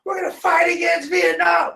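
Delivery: high, shouted protest cry; hammed-up hippie yelling a slogan; mock protester yell. Mocking hippie protesters, high shouted chant. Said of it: "hippies were like".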